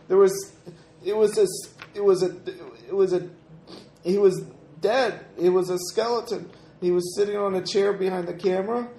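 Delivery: put-on a scared girl remembering; little girl voice. GM plays Emily recalling, raised voice.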